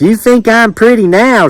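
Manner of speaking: high-pitched country woman; flattered Judith. A narrator's reading. Judith boasting to the island man, high preening voice.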